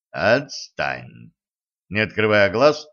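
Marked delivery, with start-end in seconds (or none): 0.1-1.3 low, flat and unbothered, eyes shut; flat, even voice. Acted. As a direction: say it evenly, without opening your eyes. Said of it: "ровным голосом попросил Кощей".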